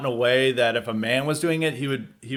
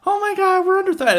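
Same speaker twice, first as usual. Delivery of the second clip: falsetto mock panic, much higher; 5 dB louder than usual. Workers calling cops on the man, falsetto mock panic. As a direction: squeal it like a fake damsel in distress. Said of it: "they're like".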